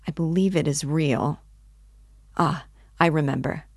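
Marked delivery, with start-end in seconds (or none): none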